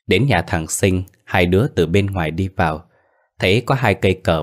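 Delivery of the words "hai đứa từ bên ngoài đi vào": plain reading voice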